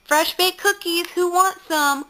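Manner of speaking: high, singsong mom voice; cheery mom calling. Retold or imitated. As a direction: imitate a mom calling out to the party. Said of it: "her mom popped open through the front door".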